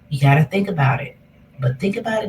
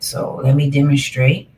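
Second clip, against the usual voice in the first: usual speaking voice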